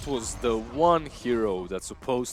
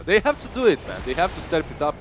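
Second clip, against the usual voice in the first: excited; 6 semitones higher, 9 dB louder than usual. Real, not acted. Urging team to make plays.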